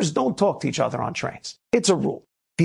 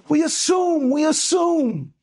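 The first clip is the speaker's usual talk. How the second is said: preached cry; 9 semitones higher, much slower than usual. Real, not acted. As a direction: call it out slowly to the whole room. Rabbi repeats lesson, preaching loud.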